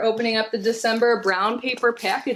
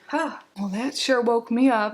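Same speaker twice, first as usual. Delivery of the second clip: usual speaking voice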